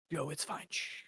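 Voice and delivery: hushed, very soft mock soothing; hushing softly. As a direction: hush him like a nurse at the bedside. Kamala hushing Biden in bed.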